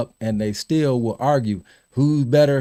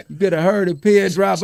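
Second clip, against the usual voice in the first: ordinary delivery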